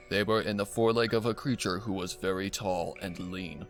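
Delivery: plain reading voice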